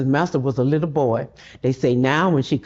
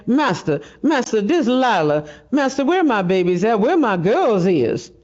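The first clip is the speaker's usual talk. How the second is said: desperate plea; 6 semitones higher than usual. Acted. Begging master for her children.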